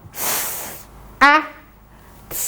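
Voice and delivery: segmenting F, A, T; "F" hissed, then "A", then a soft "T"; sounds said apart. Shown as a demonstration. Teacher sounds out the word for the class, one sound at a time. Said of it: "Do it again".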